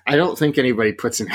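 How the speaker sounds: normal talking voice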